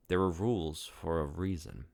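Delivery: plain reading voice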